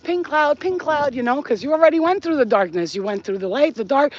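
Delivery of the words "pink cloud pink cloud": singsong. Acted. Singsong about pink cloud.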